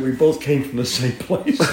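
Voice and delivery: high, loud laughing through tears; joyful emotional laugh. Real, not acted. Emotional reunion with mare.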